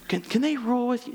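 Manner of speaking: high-pitched pleading mother; sweet old lady. Retold or imitated. Mother asking that her sons rule, sweet old lady. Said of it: "her sweet old lady talk".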